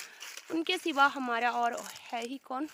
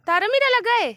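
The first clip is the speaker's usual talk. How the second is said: high, loud playful surprise; mock surprise; 10 semitones higher, 12 dB louder than usual. Real, not acted. Adult feigning surprise at a toddler's answer, high and loud.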